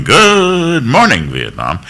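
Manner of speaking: GOOD stretched long and high, dropping on Vietnam; yelled radio sign-on. Shown as a demonstration. DJ starting his morning program, yelling. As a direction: belt out a drawn-out morning greeting. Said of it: "each program by yelling".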